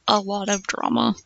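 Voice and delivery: ordinary delivery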